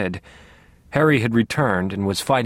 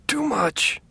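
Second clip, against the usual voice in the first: high, strained gasping words; pained gasp; 12 semitones higher than usual. A narrator's reading. Father in pain warns his son, high gasping strain. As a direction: force it out through a stab of pain. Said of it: "He gasped now".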